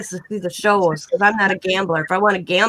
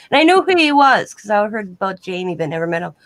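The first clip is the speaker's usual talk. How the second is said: ordinary delivery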